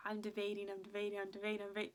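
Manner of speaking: whispered "I'm debating" under the breath; whispered, agonised repetition. Shown as a demonstration. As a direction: breathe it out like a secret worry. Agonising over texting stylist.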